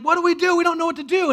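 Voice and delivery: high, loud panicked plea; panicked cry. Retold or imitated. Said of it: "they're like".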